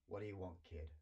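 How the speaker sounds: a gruff, unwelcoming dragon. A narrator's reading. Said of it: "he said gruffly".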